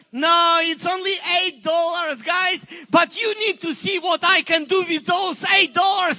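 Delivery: loud, high exultant announcement; triumphant shout. Retold or imitated. Jesus telling angels about eight dollars, triumphant shout. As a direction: proclaim it with joy.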